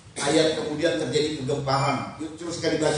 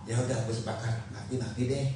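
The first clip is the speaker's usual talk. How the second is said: low, softer mock resignation; half-hearted agreement; 5 semitones lower, 5 dB quieter than usual. Shown as a demonstration. Preacher shows the wrong way to agree, half-hearted. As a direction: give in as if you don't mean it. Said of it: "Bukan kayak begini".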